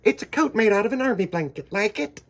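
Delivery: high, loud, quick showing off; proud grandpa. Acted. Grandpa proudly showing his army blanket coat, high quick brag. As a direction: show off the homemade coat.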